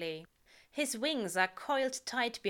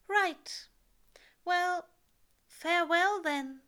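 higher, louder nervous farewell; Aziraphale voice; 10 semitones higher, 6 dB louder, slower than usual. A narrator's reading. Angel saying farewell to the world, nervous and higher. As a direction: brave but shaky goodbye, pitched up.

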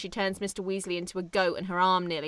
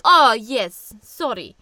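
Ivan apologising; 3 semitones higher, 10 dB louder, slower than usual. Retold or imitated. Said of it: "And he's like".

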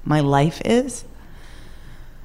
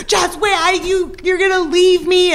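put-on much higher, panicky boyfriend; mock freak-out; 13 semitones higher than usual. Boyfriend panicking as she says she's leaving, high frantic squeal. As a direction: squeak it out like a man losing it. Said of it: "he's just freaking out".

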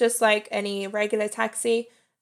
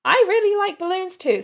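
excited; 10 semitones higher, 5 dB louder than usual. Real, not acted. Gushing about loving balloons.

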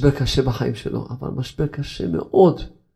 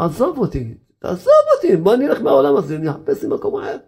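high, laughing, fed-up complaint; God fed up with the crybabies, high and laughing; God's exasperated voice; 6 semitones higher than usual. Retold or imitated. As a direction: play God throwing up his hands at the whiners. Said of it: "השם יתברך אומר".

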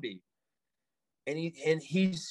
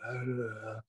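low, soft zombie groan; low mock zombie moan; 7 semitones lower, 10 dB quieter than usual. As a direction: imitate a zombie's wordless mumble, quietly. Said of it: "he starts to, like, say something".

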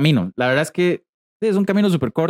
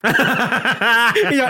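laughing; 8 semitones higher than usual. Real, not acted.

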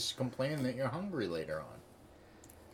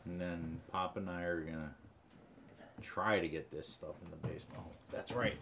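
usual speaking voice

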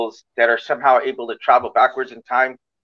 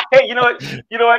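laughing; 8 semitones higher, faster than usual. Real, not acted. Host after mocked accent.

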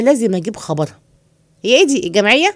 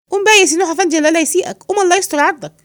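pleading; 8 semitones higher, faster than usual. Acted. Servant begs the master to get off the couch. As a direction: coax him up, imploring.

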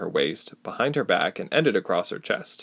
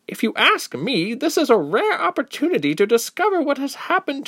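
concerned, piping up. Acted. Second woman defending Ilse from Yuti, high and concerned. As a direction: leap in to protect the girl.

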